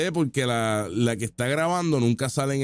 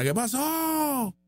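drawn-out, high-pitched mock shriek; high wail, imitating a brawler's yell; 11 semitones higher, much slower than usual. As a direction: stretch it out in a high imitation scream. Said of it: "Y le entra a puños y le grita".

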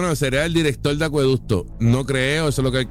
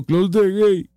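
loud, higher mock shout of Close the gate; retold yell; 5 semitones higher, 3 dB louder, slower than usual. Host retelling a lady's order to the politician, mock yell. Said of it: "me gritó".

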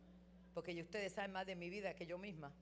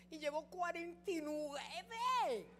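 slow, high, stretched reply; mock husband, slow and high; drawn-out husband line; 9 semitones higher, 5 dB louder, much slower than usual. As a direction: drag it out for effect.

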